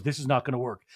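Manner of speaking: low, softened imitation of a bystander; retold quiet remark; quiet aside. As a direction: say it softly, under the row. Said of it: "Jax is really quiet".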